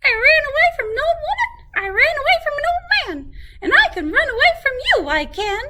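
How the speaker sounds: very high, fast, laughing boast; laughing gingerbread man. A narrator's reading. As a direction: giggle and brag at speed. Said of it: "the gingerbread man just laughed".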